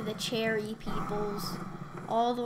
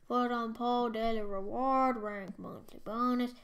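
usual speaking voice

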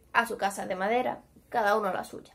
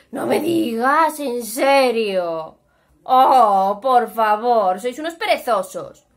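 sarcastic scolding; 7 dB louder, slower than usual. A narrator's reading. Brother scolds the lazy pigs.

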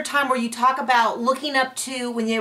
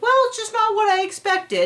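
high, mocking whiny voice; mocking Mary; 11 semitones higher than usual. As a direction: parody the wife's letdown, pitched way up. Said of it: "she's like".

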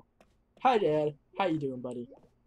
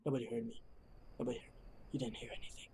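much softer, low and hushed; 6 semitones lower, 16 dB quieter, much faster than usual. Real, not acted. Gamer hoping nobody heard the yell, hushed. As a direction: hush it like covering up.

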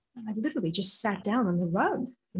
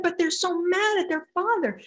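put-on high, loud childlike protest; child insisting; 9 semitones higher, 5 dB louder than usual. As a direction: insist like a child not believed. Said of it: "I said".

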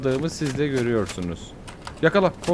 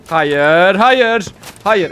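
loud, rising cry of no, the second higher; despairing no; 5 semitones higher, 8 dB louder, much slower than usual. Real, not acted. Streamer seeing his climb undone, loud despairing cry. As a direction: wail no twice as everything is lost. Falls back to the start.

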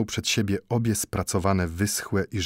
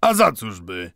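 offhand; 5 dB louder than usual. A narrator's reading. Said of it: "odrzucił swobodnie zegarmistrz".

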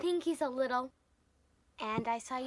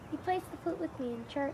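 ordinary talk

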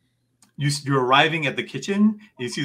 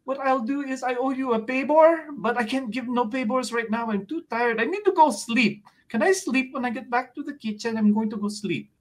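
put-on much higher, sleepy old lady voice, paybor for favor; tired grandma with accent; 10 semitones higher than usual. Lola thanking the party before going to sleep, high and tired. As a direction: mumble thanks like an exhausted grandmother. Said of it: "Lola is sitting there going".

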